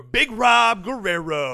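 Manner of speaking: slow, loud boxing announcer call; ring announcer. Pretend.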